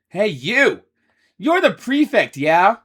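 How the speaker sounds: confrontational. Acted. Ace pokes Yuya.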